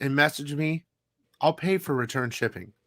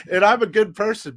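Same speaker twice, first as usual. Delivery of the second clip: said through a laugh, higher and louder; 6 semitones higher, 6 dB louder than usual. Real, not acted. Insisting to viewers he's good, still laughing. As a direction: laugh out the claim.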